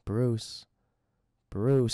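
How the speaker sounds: low, eerie calling voice; ghostly low call; voice in the head. Acted. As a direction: summon him in a deep, drawn tone. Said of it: "hearing a voice inside his head".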